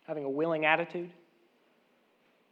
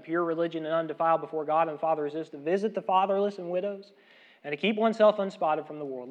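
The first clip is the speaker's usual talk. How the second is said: scripture reading; 5 dB louder than usual. Acted. Reads James 1:27 aloud.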